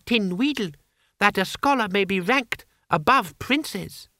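put-on high, courtly child ruler's voice; high-pitched, formal young monarch; sly boy Emperor. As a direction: needle the courtier with elaborate politeness.

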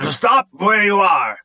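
high-pitched shouted threat, drawn out; shouting. A narrator's reading. Piggy holds the bridge crew at gunpoint, shouting. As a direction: shout a slow, high warning to freeze. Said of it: "Piggy shouted".

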